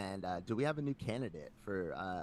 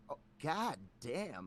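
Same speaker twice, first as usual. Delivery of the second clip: slow, drawn-out reaction; mild mock dismay; retold shock; 3 semitones higher, slower than usual. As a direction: stretch it out, replaying your dismay. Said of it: "in real time, I go".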